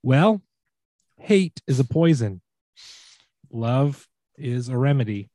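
slow dramatic recital with long pauses; Shatner poetry slam. Acted. Host reads lyrics as a poetry slam for guessing, halting Shatner-style recital. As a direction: lyrics spoken as halting, weighty verse. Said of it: "Shatner's Poetry Slam".

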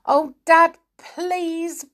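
high, drawn-out desperate plea; tearful begging. A narrator's reading. George begging Dad for the box, tearful begging. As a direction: beg slowly, close to tears. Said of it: "begged George, almost in tears".

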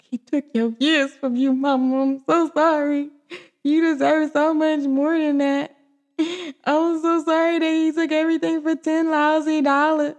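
high-pitched, whimpering commiseration; mock tearful sympathy. Vlogger reliving reaction to a film mother's loss, mock tearful. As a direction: console her in a hammed-up tearful voice. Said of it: "I was like".

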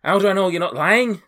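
high, suspicious challenge; shouting door guard. Acted. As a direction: challenge their story in a high voice. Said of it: "a shouting voice".

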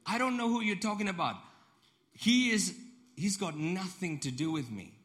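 loud, high, vehement outburst; acted furious disowning, voice way up; swearing denial. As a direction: shout it like an oath. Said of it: "but he swore".

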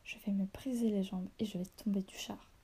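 plain reading voice

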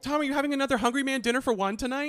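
high, loud reporter's needling; mock heckling. Co-host joins hounding Tom at his door, mock heckling. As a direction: play a pushy reporter taunting someone.

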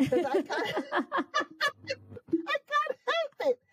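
high, drawn-out words breaking into laughter; real helpless laughing. Real, not acted. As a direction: laugh right through the words, slowly. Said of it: "I'm always laughing".

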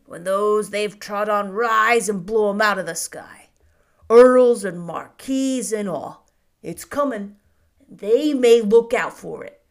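put-on loud, gruff old shopkeeper railing at lords; a storekeeper spitting scorn at the nobility; Mr Hobbs ranting. A narrator's reading. Shopkeeper rants to Cedric about earls, loud and scornful.

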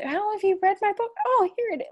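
high squeaky gushing fan; mock fan voice. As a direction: gush like a starstruck fan.

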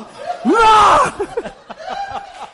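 loud, high roar of an angry bear; hammed-up enraged bear roar; mock bear roar. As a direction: roar like a bear robbed of its cubs. Acts bear robbed of cubs.

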